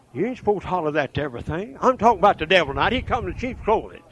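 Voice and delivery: ordinary delivery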